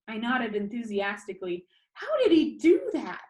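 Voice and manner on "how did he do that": eager, high and loud question; bright wonder at the trick; excited. Acted. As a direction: burst out with amazement. Excited question about the post.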